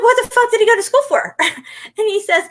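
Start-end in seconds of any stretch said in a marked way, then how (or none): 0.0-1.4 high, loud disbelieving outburst, replayed; retelling his stunned reaction, pitch shooting up; incredulous retelling. Telling a friend about a date's degree, high incredulous outcry. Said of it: "I'm like".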